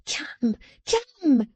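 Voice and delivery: high, sharp scolding voice; scolding little woman. A narrator's reading. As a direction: scold him like a child. Jenny's businesslike scolding.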